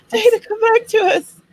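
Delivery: high-pitched call through laughter; laughing mock plea. Calling Dana back to the stream, laughing mock plea. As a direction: plead playfully while laughing.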